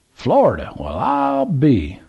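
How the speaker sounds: put-on high, excited drawl of an old country man; old Southern gentleman. A narrator's reading. Old man calls to trucker, high Southern voice. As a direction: play the curious old man at the gas pump.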